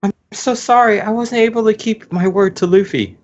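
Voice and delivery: put-on high-pitched sweet girl voice; high girly voice. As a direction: lift into a girlish falsetto. Pudding voiced by male reader.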